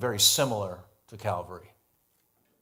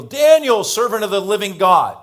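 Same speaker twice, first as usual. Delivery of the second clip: much higher and louder, calling out in anguish; an anxious king crying down into the den; troubled cry; 11 semitones higher, 16 dB louder than usual. A narrator's reading. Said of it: "with a troubled voice".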